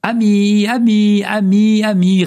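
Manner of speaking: high, loud repeating echo; écho. A narrator's reading. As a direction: repeat the word like a valley echo. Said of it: "répondit l'écho". (écho aigu et sonore)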